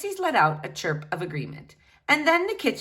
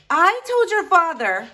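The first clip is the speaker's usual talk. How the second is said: defensive mother; 10 semitones higher, 7 dB louder than usual. Acted.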